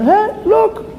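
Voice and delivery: very high, squeaky find-a-prize voice; hammed-up high-pitched delight; delighted passer-by. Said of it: "And a person walks and".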